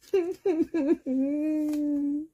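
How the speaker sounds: high giggle at his bad luck; rueful high giggle; laugh. Real, not acted. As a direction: giggle in defeat. Laughs at another miss.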